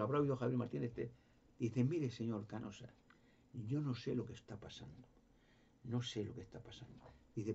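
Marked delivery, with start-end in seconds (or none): 1.8-7.0 a scared man hardly daring to speak; man answering the journalist, softer and frightened; trembling. Retold or imitated. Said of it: "me dijo temblando".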